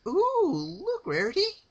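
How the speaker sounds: very high, loud giddy squeal; gleeful Pinkie. A narrator's reading. Pinkie showing Rarity the severed tail, high giddy squeal. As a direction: squeal it with delight.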